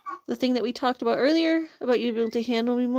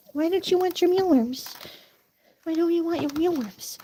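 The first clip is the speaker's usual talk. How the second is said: coaxing pet voice; 6 semitones higher than usual. Real, not acted. Coaxing chickens, higher.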